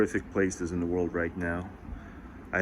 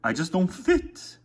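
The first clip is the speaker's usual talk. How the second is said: mock lament; 7 semitones higher than usual. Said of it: "It's like".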